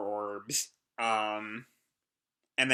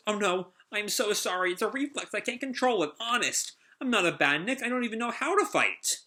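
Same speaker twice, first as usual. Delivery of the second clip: higher, anxious puppet voice; flustered apology; 8 semitones higher than usual. Retold or imitated. Wooden puppet apologizing to Sonic, high and flustered. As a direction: apologize in a rush, high and nervous. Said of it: "the puppet apologizes".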